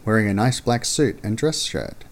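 ordinary delivery, nothing marked

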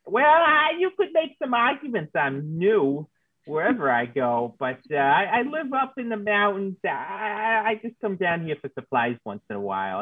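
a hint of a folksy backwoods loner; lightly voiced mountain man. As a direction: ramble amiably as a mountain dweller. Plays mountain man Philip.

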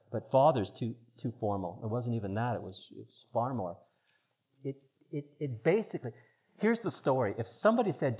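ordinary talk, nothing marked

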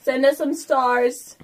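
normal talking voice

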